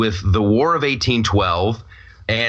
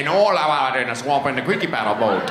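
put-on Cajun accent. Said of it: "a Cajun".

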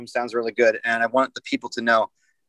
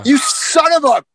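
high, loud mock outrage; 13 semitones higher, 7 dB louder, slower than usual. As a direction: yelp it in fake indignation. Mock outrage at Trae jab.

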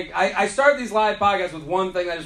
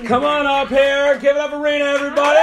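stage announcer shout; 8 semitones higher, 7 dB louder than usual. Real, not acted. Host calling the comic up to the stage, big announcer shout. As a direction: call the guest up over the applause.

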